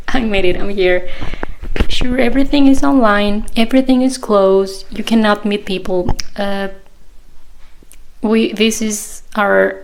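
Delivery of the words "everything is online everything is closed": ordinary talk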